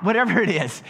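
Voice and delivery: ordinary talk, nothing marked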